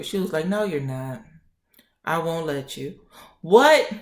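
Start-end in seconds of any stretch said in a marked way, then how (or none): 0.5-3.0 quiet refusal. Retold or imitated. Doctor gently refuses transfer. 3.4-3.9 loud, high retold "what"; shocked disbelief. Patient shocked by refusal.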